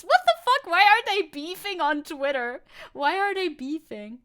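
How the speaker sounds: shocked loud cry at the news; shocked outburst. Real, not acted. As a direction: blurt out in disbelief, loud and high.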